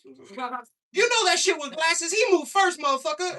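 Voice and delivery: very high, loud, slow squawking yell; sassy lady yelling. Retold or imitated. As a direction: holler it like an outraged auntie in the crowd. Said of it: "she goes".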